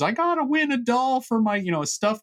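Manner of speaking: high, held-note mock singer's voice. Co-host quoting the fair song to his partner, high-pitched singer imitation. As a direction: mimic the singer's line way up high. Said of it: "and [name]'s".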